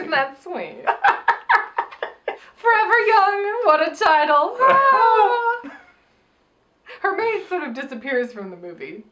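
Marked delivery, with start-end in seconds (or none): 0.8-5.7 high, loud laughing through words; laughing hard. Real, not acted. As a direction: crack up while speaking.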